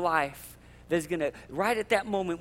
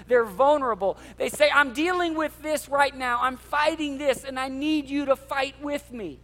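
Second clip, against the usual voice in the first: louder, higher, fired-up preaching; 6 semitones higher, 5 dB louder than usual. Real, not acted. A pastor fired up about small groups, yelling. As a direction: preach it with full passion. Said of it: "I'm yelling today".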